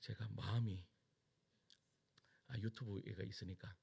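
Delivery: breathy whispered aside. Real, not acted. Preacher to the congregation, a crestfallen whisper. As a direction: drop to a whisper, a little crushed. Preacher sighs over dog photo.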